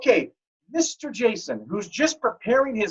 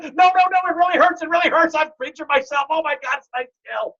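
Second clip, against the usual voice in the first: hurt friend pleading; 8 semitones higher than usual. Retold or imitated. Injured friend insisting to doubters, high and pleading. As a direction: insist it really hurts, voice up high. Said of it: "He's like".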